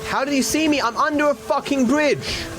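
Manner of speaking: high, frantic disbelief; panicked yelp at the screen; panicking gamer. Real, not acted. Spotted by cops, panicking.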